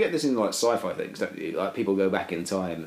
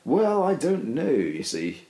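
slow, lifted posh evasion; drawn-out plummy dodge of the question; 6 semitones higher, much slower than usual. Real, not acted. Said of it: "in a more posh voice".

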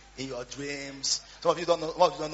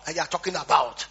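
loud, high fervent preaching; preaching at full force; 10 semitones higher, 15 dB louder than usual. Acted. Preacher recounts Bible argument, preaching loud. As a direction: preach it hard to the crowd.

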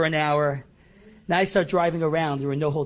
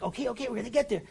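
high, soft and fast, brushing it off; quick high soothing okays; placating the kid, rushed and light; hurried reassurance; 7 semitones higher, 5 dB quieter, faster than usual. Retold or imitated. Reassuring impatient son while driving.

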